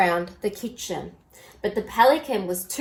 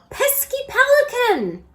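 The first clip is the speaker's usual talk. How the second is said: much higher squeaky cartoon dog; 14 semitones higher than usual. A narrator's reading. Bob the dog complaining about the pelican.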